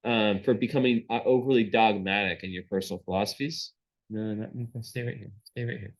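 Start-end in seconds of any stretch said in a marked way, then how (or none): 4.1-5.9 quiet aside to a pet, pitched up a little; hushed words to a pet; softly telling the cat. Real, not acted. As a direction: murmur to the cat to stay put. Speaking aside to her cat.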